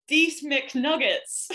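slow awkward order at the counter; slow sheepish order. Retold or imitated. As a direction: stretch it out like a humbled tourist.

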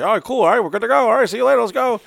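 high, loud, hurried-off goodbye; breezy brush-off. Retold or imitated. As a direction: imitate someone eagerly brushing it off. Acts out careless brush-off.

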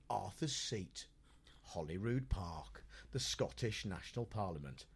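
low, soft, dramatic pauses between phrases; portentous TV reporter. Retold or imitated. Imitating an insect reporter revealing his location, portentous.

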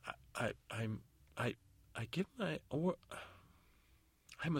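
faltering stammer rising high; cornered fairy godmother. Acted. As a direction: stammer and jump up in pitch when cornered. Godmother cornered, searching for answer.

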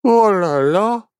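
high, drawn-out yawn through the words; slow, stretched words swallowed by a yawn. A narrator's reading. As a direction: talk through a big sleepy yawn. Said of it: "bâilla Barnabé".

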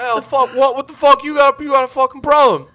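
loud, high furious British woman shouting across the bar; imitated British outburst, very loud and high; angry British yell. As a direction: bark it out like a fierce little Englishwoman. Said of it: "intense little British woman".

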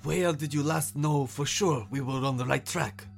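frustrated lion, pressing; barely concealed frustration. A narrator's reading. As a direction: press for an answer, holding back annoyance. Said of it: "trying to conceal its frustration".

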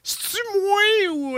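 très haut, lancé en cri; voix aiguë criée. Acted. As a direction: crie l'intro comme un jingle. Même rubrique, voix criée. (voix aiguë exagérée)

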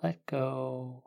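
calm guiding voice, unhurried; guided meditation. Acted.

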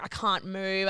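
normal talking voice

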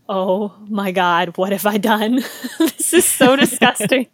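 amused, appalled regret; mock horror. Retelling her horrified first sip.

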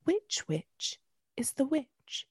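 slow, soft, sinister storyteller voice; spooky cassette narrator, hushed and slow; creepy narrator. Retold or imitated. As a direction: draw it out to frighten the children. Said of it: "And then she goes".

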